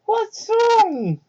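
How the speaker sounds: put-on high kid voice, worried and kind; little fox kid voice. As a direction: play a sweet young kid, eager to help. Young Tails asks.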